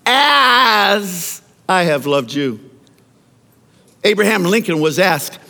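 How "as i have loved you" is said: "As I have loved you" drawn out slowly, each word weighted; slow emphasis. Shown as a demonstration. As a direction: lean on every word slowly. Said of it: "little words in the Bible that mess us up".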